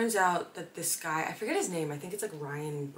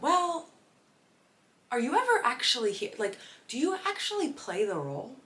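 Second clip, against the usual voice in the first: sarcastic challenge; 7 semitones higher than usual. Retold or imitated. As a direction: needle him with mock disbelief. Mock confronting Jesse Williams.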